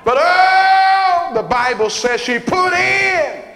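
sung high whoop, loud; preaching whoop. Acted. Preacher whooping to the congregation about giving. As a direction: moan it out like a preacher.

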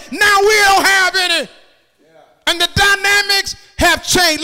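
high, shouted sermon climax; full-force preaching. Acted. Preacher begging the Lord for help through poverty, shouted preaching. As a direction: cry it out to the congregation and the Lord at full voice.

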